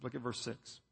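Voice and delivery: normal talking voice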